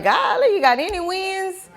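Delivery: playful high-pitched surprise; mock disbelief. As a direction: tease someone who only lists failures. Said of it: "You be like".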